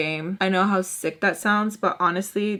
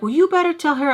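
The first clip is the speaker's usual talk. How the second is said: high, bossy quoted father; imitating her father's demand; mock dad demand; 8 semitones higher, 4 dB louder, faster than usual. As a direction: lightly mimic the pushy dad. Quoting father.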